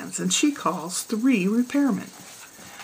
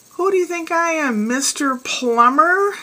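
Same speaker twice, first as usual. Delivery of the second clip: higher, louder, slow scornful retort; gruff husband; 8 semitones higher, 5 dB louder than usual. Retold or imitated. Husband scorning his wife's plumbing request, gruff and loud. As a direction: throw it back at her, slow and scornful. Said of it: "he gruffly looks at her and he says".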